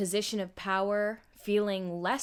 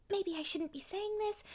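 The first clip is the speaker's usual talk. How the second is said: put-on high, soft, hesitant girl; shyly holding back; timid Hinata; 10 semitones higher, 6 dB quieter than usual. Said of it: "she still sounds a little bit timid".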